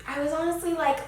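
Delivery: ordinary talk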